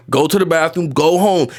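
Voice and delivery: ordinary talk, nothing marked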